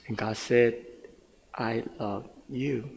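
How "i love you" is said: slow, soft and low; tender reassurance. Acted. God answering a young man who doubts he is loved, tender. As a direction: answer gently, lingering on each word. God's answer in retold prayer.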